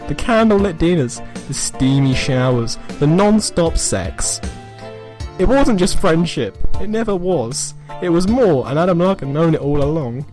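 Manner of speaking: ordinary delivery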